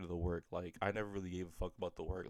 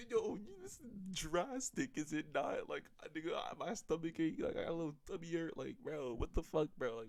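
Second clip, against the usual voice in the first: high-pitched, hammed-up disbelief; incredulous protest; 8 semitones higher than usual. Acts out panicked protest at mom.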